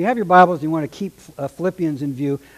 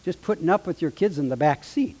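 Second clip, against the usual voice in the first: ordinary talk, nothing marked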